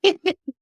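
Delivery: short, high burst of laughter; real laugh. Real, not acted. Failing to get through the intro without laughing, short high laugh. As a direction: crack up briefly, high-pitched. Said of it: "that without laughing".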